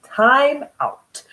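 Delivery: high, loud call for time out. Shown as a demonstration.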